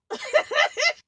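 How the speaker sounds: high, loud burst of laughter; loud incredulous laugh; loud exasperated laugh. Real, not acted. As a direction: laugh out in disbelief. Laughs mid-rant.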